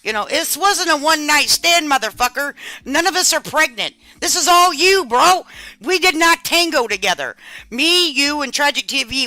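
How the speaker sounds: loud, high, heated rant; angry scolding. Real, not acted. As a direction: tell him off hard. Scolding streamer on live.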